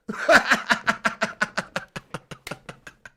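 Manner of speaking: long laugh trailing into wheeze; real laughter. Real, not acted. Streamer laughing at his own too-honest opinion, long wheezy laugh. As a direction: crack up at your own cheeky remark.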